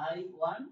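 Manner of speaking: "want" lifted with a rise at the end; rising style. Shown as a demonstration. Teacher shows the rising tune on "want". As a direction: let "want" ride up, hanging. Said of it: "মানে কথার মধ্যে একটা style থাকবে".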